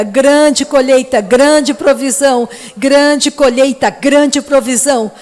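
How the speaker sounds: loud, driving prayer of thanks, phrase on phrase; fervent thanksgiving prayer. Real, not acted. Preacher thanking the Father for the morning, fervent loud prayer. Prays aloud at full force.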